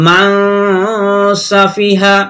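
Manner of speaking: "মান" ends in a long nasal hum before "সাফিহা"; nasal hold. Shown as a demonstration. Teacher demonstrating the nasal reading, n of "মান" sustained. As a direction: hold the n of "মান" in the nose, then release into "সাফিহা". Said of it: "এখানেও আমরা নাকে আওয়াজ নিয়ে করবো".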